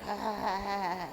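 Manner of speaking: soft faint breathy ah; faint sound. Acted. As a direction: barely make a sound. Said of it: "just a faint sound like".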